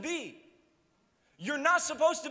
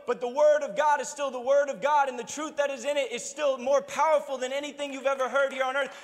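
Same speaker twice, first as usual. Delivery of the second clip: preaching at full force; 2 semitones higher, 5 dB louder than usual. Real, not acted. Preaching climax.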